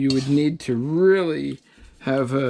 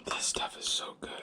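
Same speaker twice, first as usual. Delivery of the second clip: hushed, breathy whisper of delight; 13 dB quieter than usual. Real, not acted. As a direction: whisper it while savouring. Whispered while tasting.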